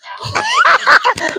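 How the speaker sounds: sudden high burst of laughter. Real, not acted. Preacher laughing at a listener's reply, high laugh.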